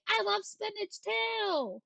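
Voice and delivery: high, slow put-on gushing voice; excited. Streamer declaring love for spinach, high gushing voice.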